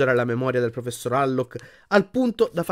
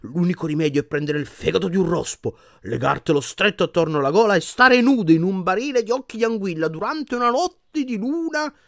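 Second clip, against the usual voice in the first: theatrical healer, climbing ever higher; pompous medieval wizard prescribing; 6 semitones higher than usual. A narrator's reading. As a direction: recite a grotesque remedy with mounting fervour. Voices medieval wizard in a portrait.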